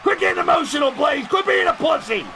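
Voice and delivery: high, scolding shout; Heel Blaze. Pretend. Heel alter ego scolds the host, high angry shout. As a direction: berate yourself as your villain alter ego. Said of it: "Heel Blaze is really trying to come out".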